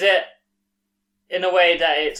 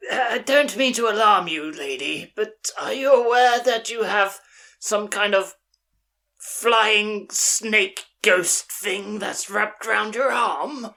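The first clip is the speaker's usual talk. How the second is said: lightly voiced character, higher pitch; higher, drawn-out enquiry; in-character cautious alert; 7 semitones higher, slower than usual. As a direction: raise it a little, addressing the lady in character. Player addresses woman in character.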